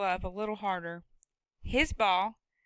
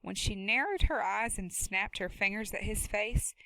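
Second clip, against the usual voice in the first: ordinary delivery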